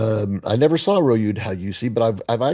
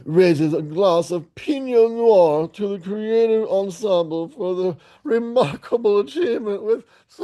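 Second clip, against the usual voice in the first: theatrical read-aloud; 10 semitones higher than usual. Acted. Toasting the creative ensemble, theatrical high put-on voice. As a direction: declaim it ever higher like an overblown toast.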